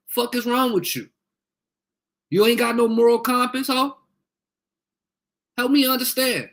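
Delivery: angry. Real, not acted. Heated rant at a troll.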